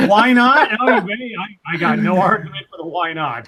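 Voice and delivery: loud, high laughing. Real, not acted. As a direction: laugh hard while talking. Laughing at joke.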